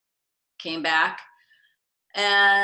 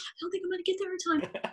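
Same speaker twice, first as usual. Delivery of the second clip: high, fast, softer panicked repeat; high quick fretful mutter; 5 dB quieter, faster than usual. Retold or imitated. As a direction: rush it again, high and trailing off in dread. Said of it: "I go".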